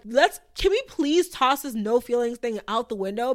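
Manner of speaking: ordinary delivery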